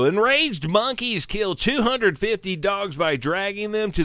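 high, dramatic headline delivery; slowing toward the end of a lurid headline; news read. Acted. Reads article headline.

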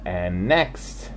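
plain reading voice

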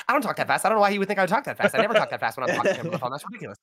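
playing his mom denying she talks fast; fast-talking mom. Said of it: "She's like".

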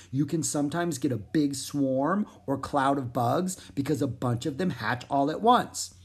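ordinary delivery, nothing marked

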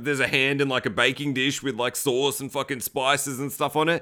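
normal talking voice

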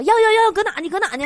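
high-pitched, hurried eagerness; eager husband. Acted. Husband reaching out for his wife's lighter, high eager voice. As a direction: grab for it with hammed-up eagerness. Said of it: "我老公赶紧的伸手说".

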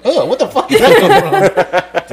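high, loud, laughing disbelief; hammed-up startled disgust; mock disgusted shock. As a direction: act appalled at a strange noise. Acts listener hearing farts in recording.

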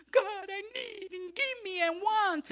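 loud, high tearful plea; crying prayer. Real, not acted. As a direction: cry out to God through tears. Crying prayer opening the sermon.

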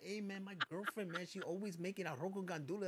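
a light touch of the Oscar voice; Oscar character voice. Retold or imitated. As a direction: lay back into the Oscar bit. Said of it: "Mexican character named Oscar".

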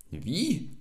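high-pitched, incredulous little cry; startled squeak from a small sprite; indignant cry. A narrator's reading. Little sprite questions the man's denial, crying out high. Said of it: "rief der kleine Wassermann".

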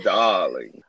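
slow, drawn-out diva "darling"; languid, affected "darling"; tepid, exaggerated darling. Shown as a demonstration. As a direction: stretch it out as a grand, unbothered reply. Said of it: "tepid response to anything you say".

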